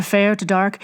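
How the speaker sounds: ordinary delivery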